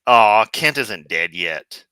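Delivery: loud, drawn-out "Aw" of letdown; mock disappointment. Host voicing the other part of himself, disappointed. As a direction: complain slowly, as if let down by the news. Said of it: "A part of me also says".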